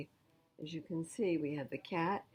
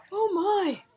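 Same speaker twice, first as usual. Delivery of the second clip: much higher, louder mock surprise; 10 dB louder than usual. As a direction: gasp it out for the children. Grandma stuck in tree.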